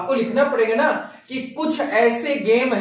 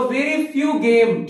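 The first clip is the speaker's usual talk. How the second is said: slow dictation; much slower than usual. Shown as a demonstration. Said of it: "teacher dictating the answer".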